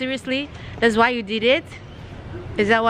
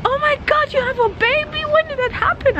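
high, gushing store lady voice, much higher. Retold or imitated. To the narrator about her baby, a high surprised store lady. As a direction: imitate the lady squealing over the baby news. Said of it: "she's like".

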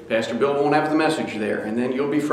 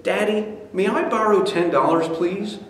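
put-on high, timid child's request; little boy; 6 semitones higher than usual. Son asking to borrow money, timid child voice. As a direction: ask sweetly as a small boy.

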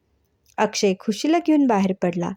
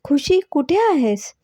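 slow, higher-pitched call; drawn-out searching call; 6 semitones higher than usual. A narrator's reading. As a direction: call out for a friend in the next room. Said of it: "तेवढ्यातच नीतूचा आवाज आला".

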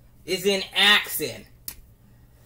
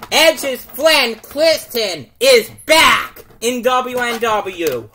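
screaming commentator; 3 semitones higher, 8 dB louder, slower than usual. Pretend. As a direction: lose it over the surprise return.

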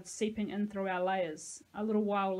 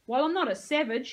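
loud, higher indignant yell; 5 semitones higher, 7 dB louder than usual. A narrator's reading. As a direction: yell back, put out. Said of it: "he yelled".

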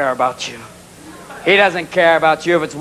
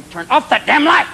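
higher, indignant cat voice; annoyed cat; 7 semitones higher than usual. Acted. Cat in the litter box to owner, indignant. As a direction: complain like a cat caught in the light. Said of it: "your cat's in the cat box going".

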